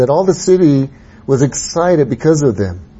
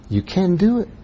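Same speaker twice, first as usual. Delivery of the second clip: soft encouragement; 5 semitones higher, 5 dB quieter than usual. Shown as a demonstration. Encouraging the congregation again.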